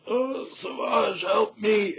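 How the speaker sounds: slow, high, groaning cry for help; wounded plea. Acted. Wounded knight begging the wizard for help, pained plea. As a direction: plead as if badly hurt, pitched high.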